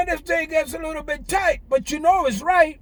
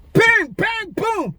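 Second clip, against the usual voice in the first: mock gunfire; 7 semitones higher, much slower than usual. Real, not acted. Imitating gunfire sounds.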